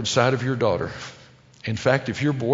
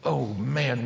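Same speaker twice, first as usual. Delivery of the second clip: ordinary talk, nothing marked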